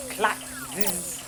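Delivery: usual speaking voice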